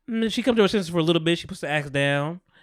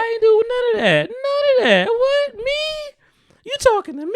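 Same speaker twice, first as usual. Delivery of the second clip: high, loud sugary denial; sweet but shrill innocent act; fake sweetness; 4 dB louder than usual. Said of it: "you say bless your heart".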